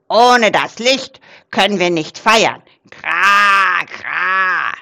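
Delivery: loud croaking raven, protesting; excited raven with harsh caws; agitated raven croak. A narrator's reading. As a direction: croak it with long drawn caws. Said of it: "krächzte Rabe Krax aufgeregt".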